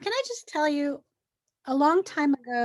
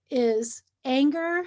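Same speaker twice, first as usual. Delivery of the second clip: usual speaking voice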